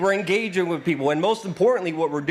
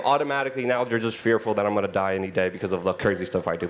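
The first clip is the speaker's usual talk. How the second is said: normal talking voice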